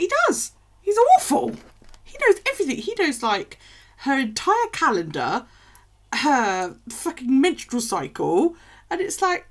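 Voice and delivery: high, loud, worked-up ranting; excited rant. Real, not acted. Ranting about the nagging husband, high and loud. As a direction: get carried away complaining.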